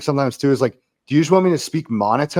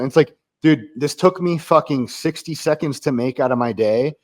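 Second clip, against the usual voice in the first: usual speaking voice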